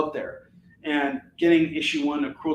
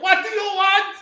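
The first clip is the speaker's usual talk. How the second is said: high, loud snapping teacher voice; mock stern teacher; 6 dB louder than usual. Mocking a strict teacher who won't allow bathroom breaks, shrill. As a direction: snap at the kid like a grumpy teacher. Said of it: "They're like".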